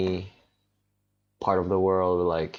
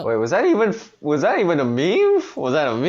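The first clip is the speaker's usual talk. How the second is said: surprised; 8 semitones higher, 6 dB louder than usual. Real, not acted. Surprised question about meme.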